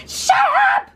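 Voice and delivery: normal talking voice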